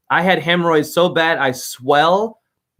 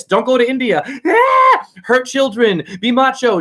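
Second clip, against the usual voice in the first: loud high mocking macho voice; hammed-up macho yelling; mock macho shout; 7 semitones higher, 3 dB louder than usual. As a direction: bark orders like a mock Roman. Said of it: "um, talk like this".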